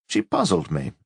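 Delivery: ordinary delivery, nothing marked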